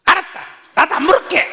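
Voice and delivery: high, loud huffy shout; angry yell back. Retold or imitated. Wife shouting back at her husband, high, loud and huffy. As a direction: fling it back in a temper.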